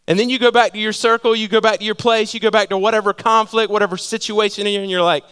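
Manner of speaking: usual speaking voice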